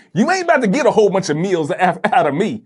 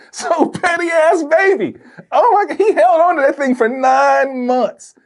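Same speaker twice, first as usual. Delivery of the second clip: laughing; 11 semitones higher than usual. Real, not acted. Laughing disbelief.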